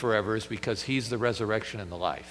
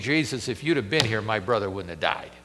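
lower, softer, grieving plea; weeping Mary; 5 semitones lower, 4 dB quieter than usual. Acted. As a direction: say it clinging to his feet in tears. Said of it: "She is weeping".

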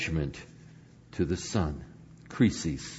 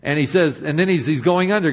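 ordinary talk, nothing marked

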